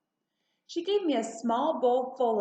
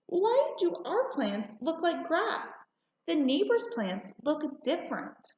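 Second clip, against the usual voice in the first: put-on child's question; 7 semitones higher than usual. A narrator's reading. Girl asking her mother about the garden, high and puzzled. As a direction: ask like a curious small child. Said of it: "I asked".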